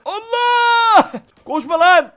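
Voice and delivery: very high, loud shriek; terrified scream. Real, not acted. Real terrified scream as an enemy charges.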